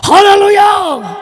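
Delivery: high, slow, booming "amen, hallelujah" like a pastor; loud hallelujah shout. Retold or imitated. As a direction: belt out the amen like the pastor. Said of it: "pastor గారు గట్టిగా". (గట్టిగా అరిచే ఆమేన్ హల్లెలూయా)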